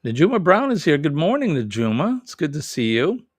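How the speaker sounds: ordinary talk, nothing marked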